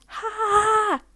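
acted-out gotcha laugh; gloating mock laugh. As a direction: crow a teasing laugh way up high. Mocking cousin after prank.

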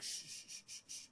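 soft, whispered shushes; soothing shushing. Acted. Imagined parent cuddling a crying baby, soothing hushes. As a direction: hush a crying baby gently. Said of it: "cuddle it and be like".

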